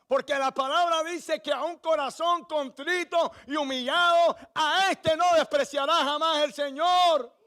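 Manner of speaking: full-force pulpit preaching. Acted. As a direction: preach it out, pleading for surrender.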